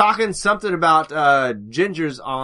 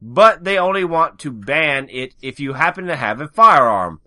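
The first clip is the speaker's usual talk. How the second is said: ordinary talk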